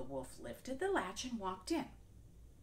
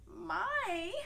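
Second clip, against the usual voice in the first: higher, wide-eyed wonder; little girl amazed; curious girl; 13 semitones higher, 5 dB louder than usual. Acted. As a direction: marvel at grandma's eyes.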